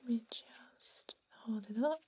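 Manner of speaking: usual speaking voice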